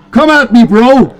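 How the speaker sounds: loud, high cocky challenge; loud taunt. Acted. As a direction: yell it like a bro. Taunting the enemy.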